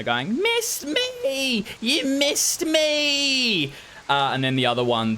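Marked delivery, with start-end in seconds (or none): 0.4-3.7 very high, drawn-out singsong taunt; mocking kid. Kids mock the farmer after his missed shot, gleeful taunting. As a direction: tease in a squeaky, stretched-out child's voice. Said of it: "they begin to mock the farmer".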